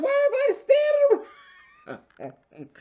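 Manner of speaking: squeaky high, slow imitation of [name]; mock high-pitched [name]. Mocking [name] at the party in a squeaky voice. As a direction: stretch it out high and puzzled. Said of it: "She's like".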